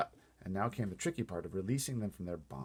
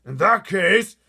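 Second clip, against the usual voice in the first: loud, high, menacing bellow; giant bellowing; 11 semitones higher, 18 dB louder, much slower than usual. A narrator's reading. Fleshlumpeater threatening the BFG, loud bellow. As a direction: roar it like a hungry giant. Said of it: "the flesh-lip eater bellowed".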